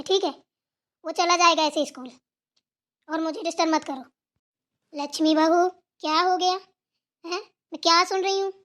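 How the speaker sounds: normal talking voice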